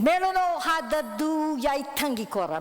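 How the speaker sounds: high, cheeky retort; boys retort in language. Retold or imitated. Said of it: "they said".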